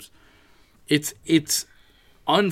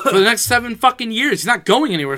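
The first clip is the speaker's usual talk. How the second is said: loud, much higher yelling; frustrated fan yelling; fired-up shout; 10 semitones higher, 13 dB louder than usual. Real, not acted. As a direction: scream at the coaching staff. Fan rant at team.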